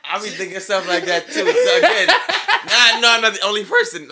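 loud, high, cracking up while talking; laughing hard. Real, not acted. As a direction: burst out laughing in excited agreement. Cracking up in recognition.

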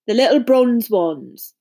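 slow drowsy gruff voice; sleepy. A narrator's reading. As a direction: still half asleep. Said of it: "said Hagrid sleepily".

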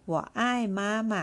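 我爱妈妈 very slow and deliberate; slow model sentence. Shown as a demonstration. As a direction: draw out 我爱妈妈 for learners to copy. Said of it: "ตัวอย่างประโยค".

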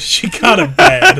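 playful high purr; flirty. Real, not acted. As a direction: say it like you're impressed. Flirty remark on a character.

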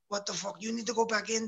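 retold coach, pitched well up; Russian coach. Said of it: "Czar, speaks Russian".

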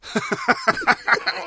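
high-pitched laugh; short giggle. Real, not acted.

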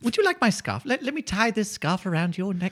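put-on high, sweet old lady voice. Old lady happily answering the burglar, high sweet voice. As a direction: play a doting old lady.

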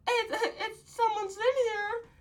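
high, whimpering mock panic behind the door; panicky squeak. Trapped boy answers the knock.

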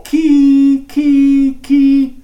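sustained high "key" held long and loud; high note. Shown as a demonstration. Turkey-call teacher showing the high note, high and held. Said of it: "put a hi-, high note".

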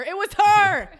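very loud, high shout; hammed-up shout of discovery; mock exclamation. Claiming mom tipped something over, mock triumphant shout. Mock proof of mom's sign.

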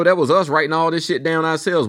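ordinary talk, nothing marked

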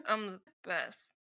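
a sarcastic imitation of the co-host; mocking echo. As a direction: tease her by parroting the words. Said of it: "I'm fine. I'm great".

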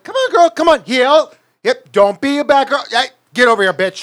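loud, high mock dog commands; calling the dog. Friend imitating him bossing his dog loudly. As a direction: shout it like ordering a dog in public. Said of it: "and you're like".

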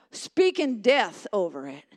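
ordinary talk, nothing marked

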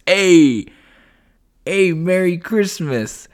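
put-on loud, brash New York accent, drawn out; a hammed-up New York wiseguy bursting in; New York Joe. New York Joe crashing the Christmas podcast to greet Chris, in a loud, brash New York accent. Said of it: "it's our friend New York Joe".

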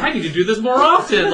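tickled by your own prank; gleeful aside. Retold or imitated. Butler pleased after pranking a guest, gleeful. Retold thought, delighted.

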